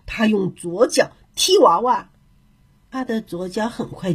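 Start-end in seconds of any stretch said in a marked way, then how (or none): none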